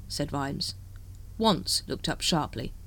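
usual speaking voice

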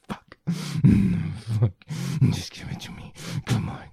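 breaking from whispers into loud bursts; urgent encouragement. Acted. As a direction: urge the partner on, voice jumping in force. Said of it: "I'm whispering dirty encouragements in your ear".

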